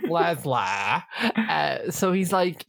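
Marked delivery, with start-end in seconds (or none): 0.1-1.0 "Leslie" said in a Geordie accent as "Lezla"; mock Geordie "Leslie" as "Lezla"; Leslie's Geordie accent. Shown as a demonstration. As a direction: Geordie "Leslie" with a rising end. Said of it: "it was the Leslie accent".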